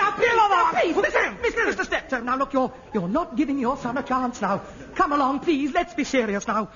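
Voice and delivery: high, loud appeal for order; flustered pleading. Acted. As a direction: plead loudly over the uproar. Director breaks up the quarrel.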